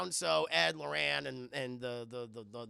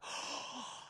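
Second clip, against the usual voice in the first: soft gasp; 6 semitones higher, 8 dB quieter than usual. Retold or imitated. Mock water spit gasp.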